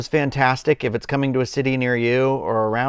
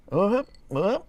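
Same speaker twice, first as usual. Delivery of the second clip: two short, high-pitched whoops; playful high whoops; 8 semitones higher than usual. Real, not acted. Adjusting the camera for viewers, high playful whoops. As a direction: pop out two little rising whoops.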